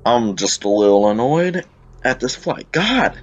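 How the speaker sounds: Southern twang with high, swooping pitch; Southern accent slipping out. Real, not acted. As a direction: let a Southern accent slip out in exasperation. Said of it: "Southern accent comes out lately".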